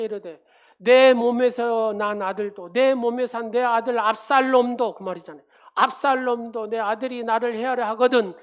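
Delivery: loud, fervent sermon delivery; preaching. Acted. Pastor preaching to the congregation, loud and fervent. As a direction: preach it out to the whole church.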